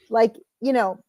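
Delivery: ordinary delivery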